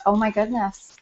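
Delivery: ordinary talk, nothing marked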